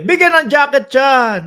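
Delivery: loud game-show host hollering; hammed-up game-show shout; Willie Revillame shout. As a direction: belt it out like a TV host handing out prizes. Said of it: "parang mala Willie, ah, Willie Revillame".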